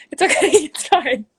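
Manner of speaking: bright laugh breaking through the words. Real, not acted. Laughing as her mom walks in on the live. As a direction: laugh it off, embarrassed but amused.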